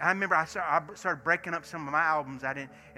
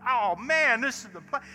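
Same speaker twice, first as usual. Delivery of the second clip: higher and louder, thrilled; excited rave; 8 semitones higher, 8 dB louder than usual. Retold or imitated. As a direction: shout your delight. Chad raving about Daryl's.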